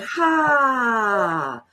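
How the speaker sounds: long audible sigh out. Shown as a demonstration. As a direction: breathe out with a sigh. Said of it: "And sigh it out".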